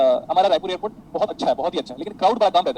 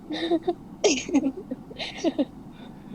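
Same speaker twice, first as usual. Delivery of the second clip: long, high-pitched laugh; 9 semitones higher, 4 dB quieter than usual. Real, not acted. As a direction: crack up at your own story. Laughs at his own anecdote. (देर तक ऊँची हँसी)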